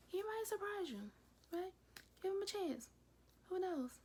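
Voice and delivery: high, coaxing sing-song; lilting nudge to give him a chance; playful teasing. Real, not acted. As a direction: tease the viewers, pitch way up. Teasing viewers about Boogie's chances.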